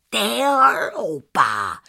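drawn-out disgusted rebuke, falling; scolding. A narrator's reading. Angry aunt scolds the foolish girl, scolding.

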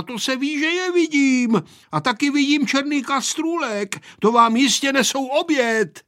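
put-on high, loud young pilot; shouting into an ear. A narrator's reading. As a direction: call out loudly to a hard-of-hearing grandpa. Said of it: "křičel mladý pilot dědečkovi do ucha". (křičet dědovi do ucha)

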